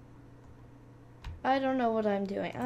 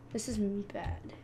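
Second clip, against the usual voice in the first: whispering; 2 semitones lower, 6 dB quieter than usual. Real, not acted. Whispered worry in game.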